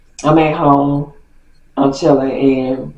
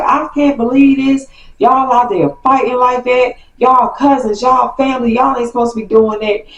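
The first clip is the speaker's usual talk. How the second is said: higher scolding aunt voice, lightly done; aunt scolding on the phone; mock aunt going off; 7 semitones higher than usual. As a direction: lecture about family, raised pitch. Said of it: "she just going off".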